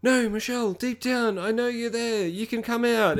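high, mock-earnest pleading voice of the nerdy guy. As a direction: coax her back in a high pleading voice. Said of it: "he's like".

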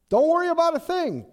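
high, loud, eager reassurance; confident reassurance. A narrator's reading. Young David telling King Saul, high and loud. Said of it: "David told Saul".

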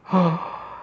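sharp shocked gasp; a dramatic intake of breath at the scandal. Acted. Teacher gasping at the gossip, shocked.

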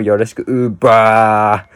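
drawn-out playful sign-off; loud slow cheeky send-off; gyaru sign-off. Acted. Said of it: "ギャル語風にやっちゃうよ". (ギャル風の締め)

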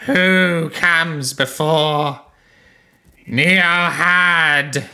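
put-on slow, loud croaking old king. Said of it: "you hear a croaking voice echo out".

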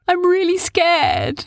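put-on high, slow frightened boy; a small scared voice. A narrator's reading. Arthur tells Oren about the beasts, scared and high. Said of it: "said Arthur".

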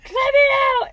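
high, loud pleading yell to be let out; hammed-up shout at the locked door; mock yell. Ivy yelling at her friend to open the door, high mock yell. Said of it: "she's like".

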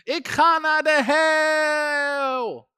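high, long-drawn howling scream; hammed-up demonic shriek; screaming spirit. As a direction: imitate a demon wailing, stretch the last word out. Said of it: "die geest begon te schreeuwen".

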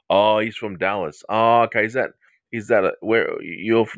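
ordinary delivery

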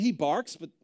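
usual speaking voice

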